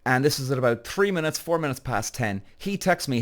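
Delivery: ordinary delivery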